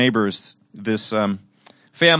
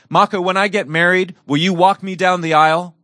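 put-on higher, louder voice of an eager girl; eager young girl; 7 semitones higher, 6 dB louder, faster than usual. Girl asking her caretaker about her wedding, imitated eager voice. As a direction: imitate a girl blurting a big question.

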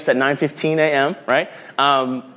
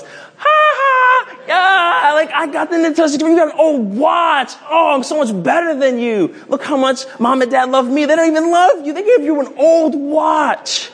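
gloating brother; 9 semitones higher than usual. Pretend. Older brother taunting his sibling over the Nintendo, gloating. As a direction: lord it over your little brother. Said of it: "He goes".

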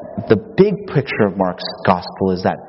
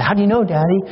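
high-pitched imitation of a child; questioning little boy; 9 semitones higher, faster than usual. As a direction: ask Dad as a doubting little kid, voice lifted. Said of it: "the kid hears the bee".

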